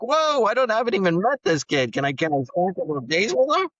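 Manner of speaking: high, loud, caught-off-guard protest; startled disbelief. Retold or imitated. New foster dad to the agency, high startled protest. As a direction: yelp it like you've just been handed a stranger's kid. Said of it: "I was like".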